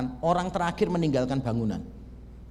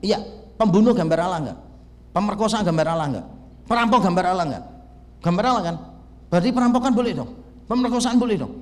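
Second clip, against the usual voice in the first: corner him and shame him; aggressive debater; 2 semitones higher, 4 dB louder, faster than usual. Shown as a demonstration. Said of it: "saya itu mempermalukan dia". (nada debat menyerang dan keras)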